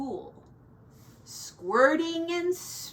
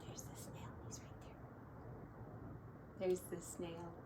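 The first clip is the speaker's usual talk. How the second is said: whisper; 6 semitones lower, 20 dB quieter, much faster than usual. Real, not acted. Pointing out to child.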